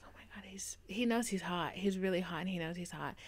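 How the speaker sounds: soft breathy hush, half whispered; hushed inner gasp. Retold or imitated. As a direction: say it under your breath like a secret thought. Thinking it to herself at gym.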